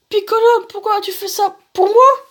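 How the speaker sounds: very high, pleading voice; distraught young hero. Acted. Gohan asks Piccolo why he sacrifices himself, distraught young hero. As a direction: plead in hammed-up disbelief.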